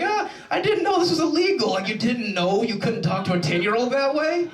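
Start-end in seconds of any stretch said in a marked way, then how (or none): 0.0-1.7 high mock-innocent excuse; hammed-up imitation of a caught man's excuse; protesting caught man. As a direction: plead ignorance in a much higher voice. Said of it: "the guys are always like".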